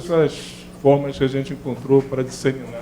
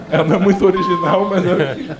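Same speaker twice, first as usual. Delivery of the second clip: laughing; 3 semitones higher, 4 dB louder than usual. Real, not acted. Laughs at the ad he played.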